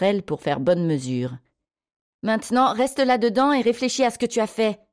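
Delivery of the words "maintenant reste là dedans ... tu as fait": high-pitched scolding order; heated, raised-pitch command; angry cry. A narrator's reading. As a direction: scold a child shut away as punishment. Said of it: "cria-t-elle".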